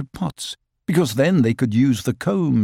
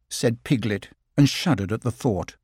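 plain reading voice

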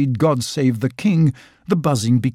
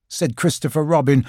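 ordinary delivery, nothing marked

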